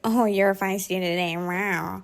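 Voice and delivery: condescending teasing voice; patronizing. Retold or imitated. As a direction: talk down to her. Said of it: "patronizing and be like".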